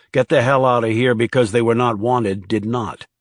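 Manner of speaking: plain reading voice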